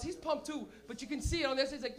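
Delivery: ordinary talk, nothing marked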